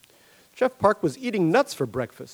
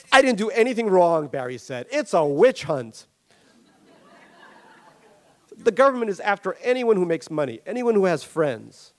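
raised, aggrieved protest, slowing on the witch hunt; mild mock outrage from a rich man; indignant Barry; 6 semitones higher, 4 dB louder than usual. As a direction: rail against the government.

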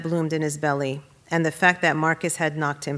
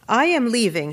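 higher, louder declaration; firm raised-voice announcement; announcing; 6 semitones higher, 5 dB louder than usual. A narrator's reading. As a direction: announce it to the room. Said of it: "he announced".

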